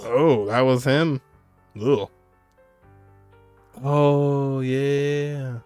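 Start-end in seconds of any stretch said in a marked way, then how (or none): none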